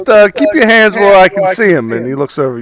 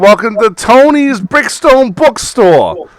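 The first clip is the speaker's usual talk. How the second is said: a slightly louder salesman's greeting, slowing at the end; lightly voiced eager bookstore owner; pushy shopkeeper; 7 semitones higher, 4 dB louder than usual. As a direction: welcome the customer like a pushy shopkeeper.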